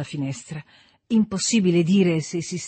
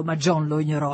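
plain reading voice

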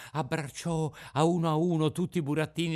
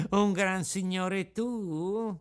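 slow, teasing disbelief; a slow, scornful fox mocking Pinocchio; 6 semitones higher, much slower than usual. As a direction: sneer at the boast, stretching the words. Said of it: "cominciò a ridere di un riso sguaiato e canzonatore".

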